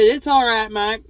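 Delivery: a hint of the boss brushing it off; reassuring boss. Boss Jason telling Mike not to worry, reassuring.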